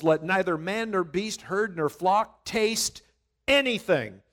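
loud, emphatic scripture reading; fervent preacher reading; preaching. Acted. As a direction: proclaim the king's decree with force.

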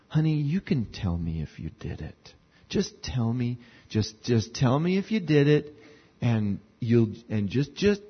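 put-on soft, low coaxing of a guilty child; coaxing parent. Parent coaxing a guilty kid to confess, soft and low. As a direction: coax the kid gently, down at their level. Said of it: "they get down kind of at their level and they say".

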